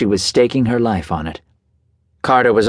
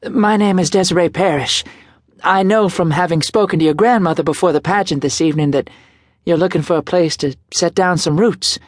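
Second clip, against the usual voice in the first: light, higher woman's voice; young woman voice; 8 semitones higher than usual. A narrator's reading. Desiree voiced in dialogue.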